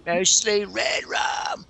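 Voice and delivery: high, slow put-on child movie voice; Danny from The Shining. Joking about two child actors together, high slow Danny imitation. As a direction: drag it out high, mimicking a spooky film kid. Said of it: "the kid that played Danny from The Shining".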